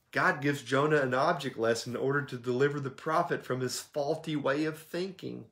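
usual speaking voice

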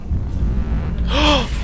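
sharp frightened gasp; startled gasp. Real, not acted. Jump scare in horror game.

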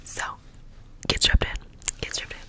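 rapid close-mic whispered trigger words; fast aggressive asmr. Acted. ASMR intro, repeated trigger words.

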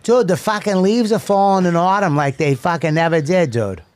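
hammed-up Boston accent. Boston local fussing over autumn leaves to a pal, mock Boston accent. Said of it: "in a Boston accent".

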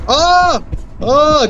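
sudden high alarmed yelp; startled cry. Real, not acted. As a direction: yelp in shock as danger hits. Player's character about to die.

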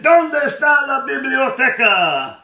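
high, loud and slow out the cab window; yelled broken Spanish. Retold or imitated. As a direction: shout the question at the bystanders, drawn out and high. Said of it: "and I yell out".

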